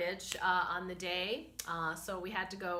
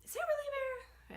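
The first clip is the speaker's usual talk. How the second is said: doubtful inner voice; 5 dB quieter than usual. Retold or imitated.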